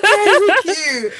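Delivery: high, slow, laughing "there's a queue"; indignant laughing protest. Retold or imitated. Woman repeats to queue jumper, slow high mock outrage.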